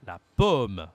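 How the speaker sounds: pomme leaned on loudly, with an open O. Shown as a demonstration. Teacher contrasts apple with palm, loud open O in pomme. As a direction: punch pomme low, O open. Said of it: "c'est différent de apple".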